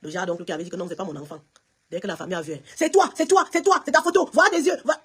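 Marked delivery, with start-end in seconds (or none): 2.7-5.0 high, emphatic retold outcry; excited family exclamation. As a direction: blurt it out like relatives spotting a likeness. Retold family recognising the child.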